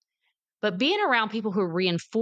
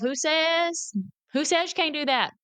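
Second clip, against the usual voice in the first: higher-pitched challenge to oneself; challenging self-talk; 8 semitones higher than usual. Acted. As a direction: push back at your own doubt, voice raised in pitch. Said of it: "I have to tell myself".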